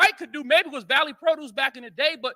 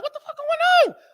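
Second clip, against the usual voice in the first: shocked outburst; 4 dB louder than usual. Retold or imitated. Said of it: "I'm like".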